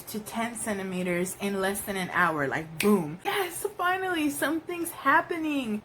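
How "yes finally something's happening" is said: high, thrilled rush of relief; reliving her relief at finally progressing; relieved excitement. Retold or imitated. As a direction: cheer it like finally some progress.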